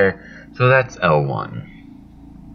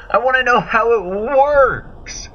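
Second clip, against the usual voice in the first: very high, drawn-out cry; excited outburst; 4 dB louder, slower than usual. Acted. Player demanding an explanation of the core, high outburst. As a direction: burst out high and slow, demanding to know.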